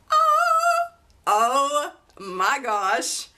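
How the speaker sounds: very high sung oh, then slow and loud; excited squeal. Real, not acted. Showing viewers the old design, a sung squeal. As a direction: gush it, singing out the first oh. Reacting to the tassel detail.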